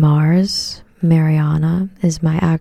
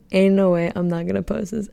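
normal talking voice

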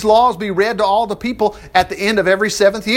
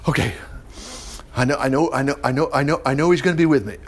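soft, low, hurried brush-off; mock impatient churchgoer; 5 semitones lower, 5 dB quieter, faster than usual. Preacher voicing a churchgoer who has heard it all, soft and dismissive. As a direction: rattle it off like a bored listener.

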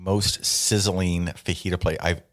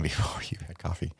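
whispered aside; 7 dB quieter than usual. Real, not acted. Joking aside about herself.

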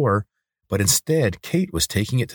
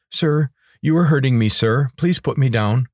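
mildly distressed, higher-pitched plea; pleading lizard; 7 semitones higher, 4 dB louder than usual. Acted. Hurt lizard asks sir to put him down, pleading. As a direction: beg politely with raised pitch.

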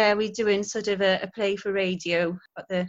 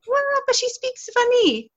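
very high, louder imitated child; protesting kids; 5 dB louder than usual. Schoolchildren arguing with their teacher, protesting. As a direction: argue back like a baffled schoolkid.

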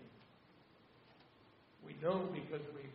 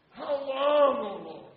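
1 anguished lament; 9 semitones higher, 20 dB louder, much slower than usual. Retold or imitated. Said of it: "the songs".

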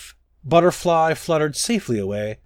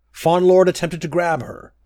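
plain reading voice

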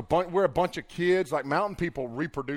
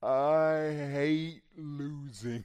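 very slow, dragged-out words like Nicole; imitating slow, slurred speech; 3 semitones lower, 4 dB quieter, much slower than usual. A friend in a wheelchair says she hates losing, very slow and slurred. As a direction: mimic her slow way of talking. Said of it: "she talked real slow".

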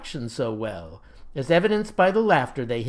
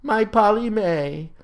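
slow, higher lovelorn lament; weeping wail; 5 semitones higher, 3 dB louder than usual. A narrator's reading. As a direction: draw out the heartbroken cry. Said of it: "he wept".

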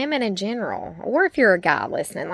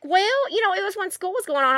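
lightly voiced kid, pitched high; retold kid's excuse; 10 semitones higher than usual. Son explains himself to mom, high imitated excuse. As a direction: make a high, mock-defensive excuse.

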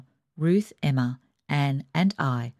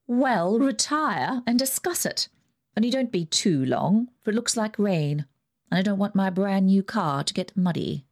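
put-on higher, imperious old aunt; Aunt Ida's voice; 4 semitones higher than usual. Rich aunt dismissing the family, higher and bossy. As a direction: lift the pitch and command like a rich aunt.

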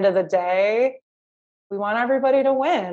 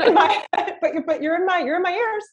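laughing; 10 semitones higher, faster than usual. Real, not acted. Laughing while praising host.

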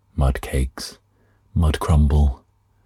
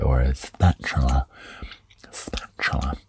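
whispered aside; 5 dB quieter than usual. Real, not acted.

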